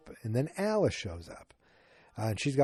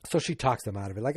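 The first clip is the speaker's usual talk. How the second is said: ordinary talk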